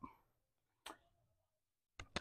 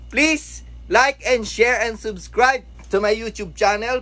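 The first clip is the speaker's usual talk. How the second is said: drawn-out outro plea; 6 semitones higher, slower than usual. Acted.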